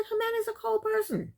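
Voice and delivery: hammed-up imitation, "Jimena's a cold person." with a falling "cold"; mock mother's verdict. Mother telling Mike about her daughter, mock disapproving. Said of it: "But she didn't say the way I'm saying it".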